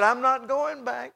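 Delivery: high, slow, insistent quote; emphatic retold refusal. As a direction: dig in slowly on the refusal. Retold resolve after desire grows.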